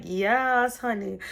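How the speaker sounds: hammed-up yes honey; sassy diva. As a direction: toss it off like a diva who knows she got the part. Diva self-assurance after winning role.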